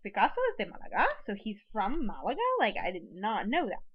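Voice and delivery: demonstrated surprise, pitch swooping up on "Málaga"; surprise, disbelief. Shown as a demonstration. Teacher models disbelief about new information, surprised. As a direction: act amazed by news you didn't know. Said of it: "expressing surprise".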